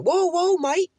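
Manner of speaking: high-pitched mock British yelp; squeaky comic British accent; scared British dude. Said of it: "He's like some British dude".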